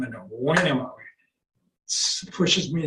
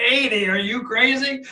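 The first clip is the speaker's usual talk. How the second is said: incredulous people; 6 semitones higher, 3 dB louder than usual. Retold or imitated. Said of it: "they say".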